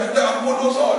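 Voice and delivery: ordinary talk, nothing marked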